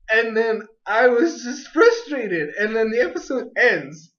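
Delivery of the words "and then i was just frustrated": ordinary talk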